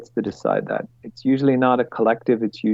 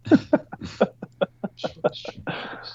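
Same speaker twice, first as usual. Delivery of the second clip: high, breaking burst of laughter; helpless laugh at oneself; 9 semitones higher, 3 dB quieter than usual. Real, not acted. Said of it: "I'm laughing".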